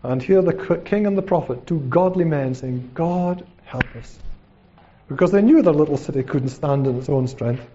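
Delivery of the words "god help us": hushed, humble plea to God; quiet cry for help; prayer. Acted. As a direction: pray it softly, falling away. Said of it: "they cried out in prayer".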